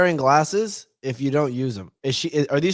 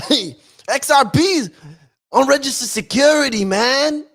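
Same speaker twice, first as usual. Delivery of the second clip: loud, high hammed-up New Yorker judge; brash mock New York judge voice; mock New York accent; 9 semitones higher, 6 dB louder than usual. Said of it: "What was the accent again from a, a native New Yorker?".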